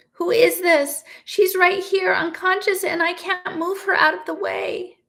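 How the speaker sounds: a trapped father begging an unseen stranger for help; desperate plea. A narrator's reading. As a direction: plead in panic for help. Trapped man pleads with unseen watcher.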